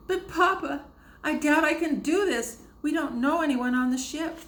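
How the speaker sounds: high, loud, crying plea; tearful pleading. A narrator's reading. Susanna begging her father in tears, tearful.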